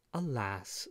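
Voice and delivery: soft, subdued sigh of regret; sadly. A narrator's reading. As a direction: lament quietly. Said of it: "said the stranger sadly".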